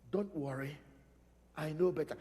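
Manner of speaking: low, hushed comfort; gentle divine reassurance. Acted. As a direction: soothe softly as an all-knowing father. God comforting his child.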